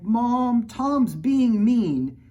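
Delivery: put-on high, loud whiny child's yell; little girl yelling. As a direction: yell for Mom like a tattling toddler. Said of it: "Then I yelled".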